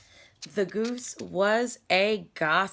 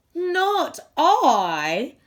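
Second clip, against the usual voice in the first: loud, brisk goose voice refusing; a lazy goose brushing off the hen; 8 semitones higher, 9 dB louder than usual. Acted.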